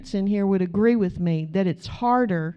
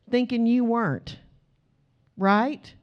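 usual speaking voice

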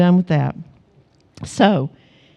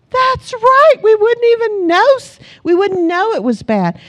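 excited agreement; 11 semitones higher than usual. Retold or imitated. Mom cheering her husband's answer to their son, high and excited. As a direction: gush like a proud mom who just got it. Mom echoing son's wisdom.

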